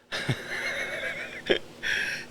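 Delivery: soft, voiceless chuckle; quiet laugh. Real, not acted. Laughing at own childhood fear of bulletins, soft chuckle.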